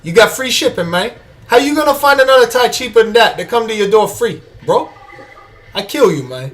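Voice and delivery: high, fast mock Cuban gangster threats; Tony Montana accent. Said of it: "Dave suggested Tony Montana".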